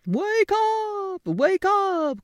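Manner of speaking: very high loud crow; crowing rooster. Acted. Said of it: "crowed the rooster".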